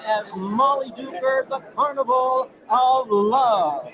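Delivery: booming, slow ring announcer call. Shown as a demonstration. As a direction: roll it out like a fight-night introduction. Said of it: "how would you introduce it in your announcer voice".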